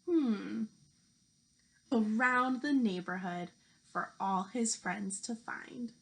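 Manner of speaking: lively picture-book storytelling voice; reading to children. Acted. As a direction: read it out like a teacher at story time.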